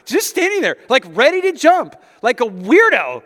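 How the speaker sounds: breathless, amazed build-up to the danger; excited storytelling. Real, not acted. Excited telling of toddler story.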